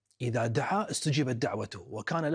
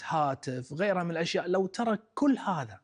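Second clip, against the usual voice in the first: ordinary talk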